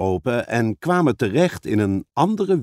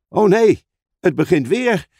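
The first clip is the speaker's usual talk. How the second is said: higher, louder cry of dismay; alarmed; 7 semitones higher, 4 dB louder than usual. Acted. Edmond feeling the pull return, alarmed. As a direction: dread as it starts up. The magic pull starts again.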